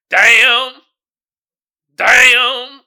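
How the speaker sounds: loud hammed-up wail on damn; mock anguished cry. Closing his parody country song, a loud mock wail. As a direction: howl it like a jilted cowboy.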